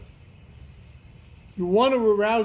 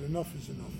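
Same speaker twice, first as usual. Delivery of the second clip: retold private thought, soft and lower; said to himself; 3 semitones lower, 9 dB quieter than usual. Man fed up, talking to himself, softly. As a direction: say it low, as if to yourself. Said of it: "I said this to myself".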